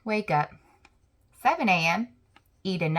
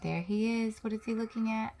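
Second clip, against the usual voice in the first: soft, hushed wonder; 3 semitones higher, 8 dB quieter than usual. Real, not acted. Teacher hushing her class over the picture.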